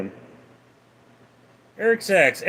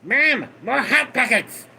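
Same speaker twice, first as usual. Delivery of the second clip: high, demanding cartoon kid yelling for food; bossy brat shouting an order; spoiled kid demanding snacks from mom; Cartman voice; 7 semitones higher than usual. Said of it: "yes, Eric Cartman".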